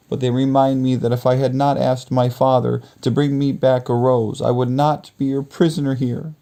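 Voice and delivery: put-on high girl's voice. Beauty answering the beast in the garden, high girl's voice. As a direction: speak up high as the young heroine.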